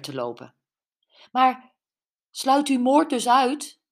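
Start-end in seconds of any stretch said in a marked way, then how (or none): 1.3-3.8 higher, louder, slightly let-down question; almost disappointed. A narrator's reading. Brenda questioning the officer about murder, almost disappointed. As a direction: press the question with a touch of disappointment. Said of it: "Brenda klonk bijna teleurgesteld".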